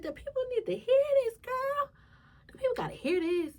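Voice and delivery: high, loud excited plea; excited urging. Retold or imitated. Urging her mother to share her story, excited and high. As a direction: squeal it with excitement. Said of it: "I said".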